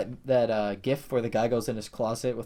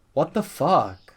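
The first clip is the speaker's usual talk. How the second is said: what the fuck, stretched slowly and louder; slow disbelief; 6 dB louder, much slower than usual. Real, not acted. Fan outraged at a team throwing, slow disbelief.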